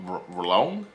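"wrong" attempted with an L after the R, questioning; tongue-twisted "wrong" with a stray L, rising at the end; mispronounced wrong. Shown as a demonstration. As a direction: haltingly try "wrong" as "r-, rlong?". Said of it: "How did I just say that?".

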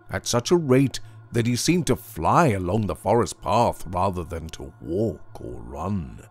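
plain reading voice

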